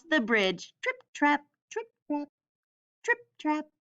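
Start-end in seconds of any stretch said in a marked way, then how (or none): none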